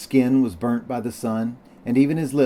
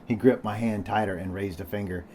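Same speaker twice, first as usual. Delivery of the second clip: ordinary delivery, nothing marked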